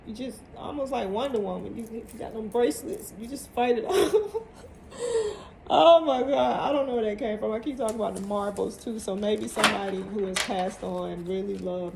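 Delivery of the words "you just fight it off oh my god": breaks into laughter mid-line; giggling at her own joke; laughing. Real, not acted. Reader cracking herself up over Wonder Woman bracelets, laughing.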